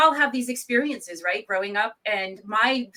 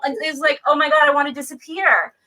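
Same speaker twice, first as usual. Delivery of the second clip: ordinary talk, nothing marked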